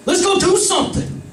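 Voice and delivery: put-on high, excited drunk suggestion; drunk buddy. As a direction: pitch it up like a tipsy friend with an idea. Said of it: "and we're like".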